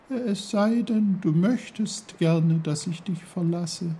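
very high, tiny flower voice; reply as a dainty talking flower; squeaky-high small character; little snowdrop. A narrator's reading. Snowdrop offers to leave.

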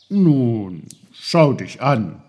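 loud, emphatic instructing voice; knowing uncle. Acted. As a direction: tell the boy firmly and loudly.

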